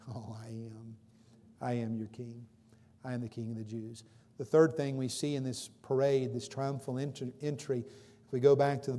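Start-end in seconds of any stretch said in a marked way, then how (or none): 0.1-4.0 quiet. Acted. Jesus quietly claims kingship.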